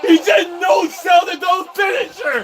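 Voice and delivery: high, loud, slow disbelieving yell; stunned yelling. Real, not acted. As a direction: shout it out in pure disbelief. Streamer stunned by no-sell.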